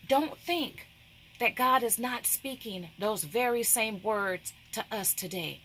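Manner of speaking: ordinary delivery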